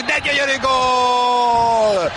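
long drawn-out goal shout; excited hungarian sports commentary. Real, not acted. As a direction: stretch out the goal call. Goal scored in live commentary. (elnyújtott gólkiáltás)

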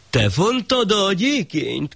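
high, slow questioning shah voice; the shah interrogating the weaver; haughty shah asking slowly; stern shah. Acted.

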